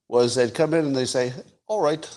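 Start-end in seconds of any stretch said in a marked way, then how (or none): 1.7-2.1 hint of a Liverpool accent, pitched up; lightly put-on Liverpudlian lilt. Beatle arriving to start a songwriting session, Beatles accent. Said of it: "this is my Beatles accent".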